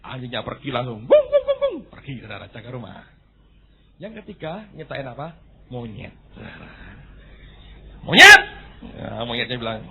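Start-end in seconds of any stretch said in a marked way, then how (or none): 1.1-1.8 high, loud imitated barks; dog barking. The dog runs off barking, imitated high and loud. 8.0-8.4 high, loud shout of the name; loud call. Acted. Preacher shouts out the monkey loudly.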